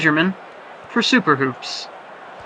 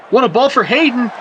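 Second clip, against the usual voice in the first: excited call; 6 semitones higher, 3 dB louder than usual. Real, not acted. Big chance in live commentary.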